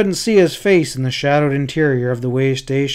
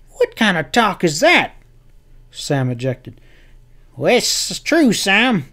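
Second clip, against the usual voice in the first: put-on rough, raised old cowhand voice; harsh; 6 semitones higher than usual. A narrator's reading. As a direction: bark it out harshly. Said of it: "said harshly".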